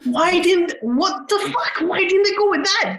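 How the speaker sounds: high, loud, baffled protest; incredulous outburst. Real, not acted. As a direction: blurt it out in disbelief. Learns of dropped suicide storyline.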